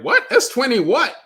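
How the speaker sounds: high, loud incredulous "what?"; mock a baffled viewer, pitch shooting up; imitating a puzzled fan, loud and high; confused what. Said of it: "people would be like".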